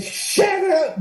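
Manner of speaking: high, sudden shut up; jokey high-pitched retort; mock snap. Retold snap at trainer.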